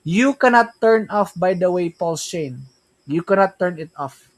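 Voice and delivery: ordinary talk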